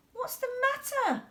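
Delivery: high, concerned question. A narrator's reading. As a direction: ask kindly in a high voice. George asks dog.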